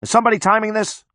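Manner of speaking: high, quick yelled question; yelling. A narrator's reading. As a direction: yell it out fast to everyone around. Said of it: "Mechanic yells".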